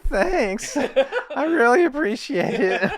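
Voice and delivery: high, giggling thanks through laughter; flattered giggle; laughing bashful thanks. Real, not acted. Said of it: "you have a sexy voice".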